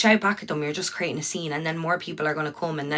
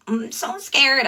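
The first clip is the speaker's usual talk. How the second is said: mocked scared argument; 9 semitones higher, 3 dB louder, much slower than usual.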